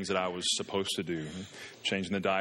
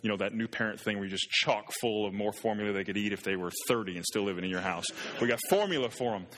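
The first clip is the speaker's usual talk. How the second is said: usual speaking voice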